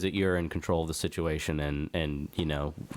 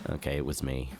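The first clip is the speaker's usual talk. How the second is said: sheepish quiet; 7 semitones lower, 6 dB quieter than usual. Pretend.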